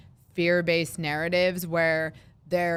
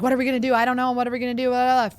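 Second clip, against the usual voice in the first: higher and louder, a panicky flustered leader; mock panic; 6 semitones higher, 4 dB louder, much faster than usual. Mocking a panicking leader who can't solve anything, in a higher, louder frantic voice. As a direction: play the leader who's lost it, voice up and pushing. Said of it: "freaking out over".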